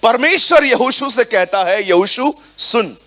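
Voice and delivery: forceful preaching. Acted. Pastor preaching the Jordan crossing to the congregation, forcefully. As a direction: drive the scripture home to the church.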